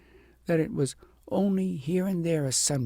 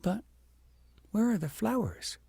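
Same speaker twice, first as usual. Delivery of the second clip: puzzled princess; 8 semitones higher than usual. A narrator's reading. Princess wonders aloud to herself.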